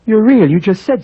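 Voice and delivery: sudden high, loud astonishment; a gasp of amazement; startled disbelief. Acted. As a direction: blurt it out, shocked she's alive. Man startled that painting speaks.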